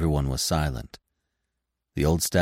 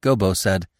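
usual speaking voice

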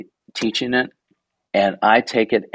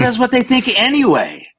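higher, half-joking protest; mock indignant; 8 semitones higher than usual. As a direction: toss it back as a playful comeback. Retold reply to the Santa joke.

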